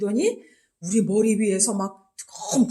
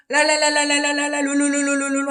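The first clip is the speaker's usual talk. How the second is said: imitating tongues; 5 semitones higher than usual. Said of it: "방언을 막 하는 거예요".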